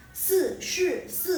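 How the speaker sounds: "Si, shi, si" high with a quick fall; fourth-tone syllables. Shown as a demonstration. Teacher reads the number four phrase, fourth-tone falls. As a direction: drop each of "Si, shi, si" from a high start. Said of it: "terus nada keempat itu artinya".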